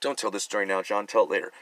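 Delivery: quiet aside to self; muttered self-aside. Real, not acted. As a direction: scold yourself under your breath. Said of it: "telling himself to stop".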